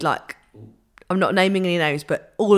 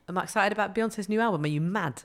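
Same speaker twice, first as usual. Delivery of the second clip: imitating [name]; 7 dB quieter, faster than usual. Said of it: "they ask her about [name]".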